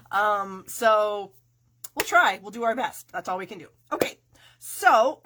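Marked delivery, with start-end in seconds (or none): none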